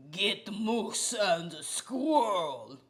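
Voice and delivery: slow, mock Russian cartoon villain accent. Russian accent, quoting the Bullwinkle villain, slow and mocking. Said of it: "from Rocky and Bullwinkle, the Russian guy".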